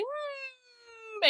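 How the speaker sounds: high nasal-free little dog whimper; imitated dog whine. Imitating the dog begging for chicken, high whimper. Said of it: "he's like".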